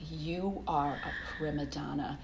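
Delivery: unvoiced cutting whisper. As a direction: hiss it coldly. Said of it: "he was like".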